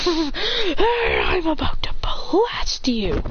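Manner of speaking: breathy laugh then a slow whispered threat; gloating bully whispering a threat; evil whispered threat. Acted.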